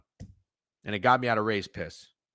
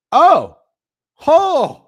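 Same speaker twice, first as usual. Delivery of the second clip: two rising, much louder and higher oh's; thrilled shouts of oh; excited whoops; 14 dB louder than usual. Real, not acted. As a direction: whoop as the bet comes through. Excited reaction to a hit.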